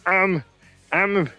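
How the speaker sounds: high, dopey mock voice of a friend; imitating Brandon. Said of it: "That's basically what he sounds like".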